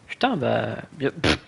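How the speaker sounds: ordinary talk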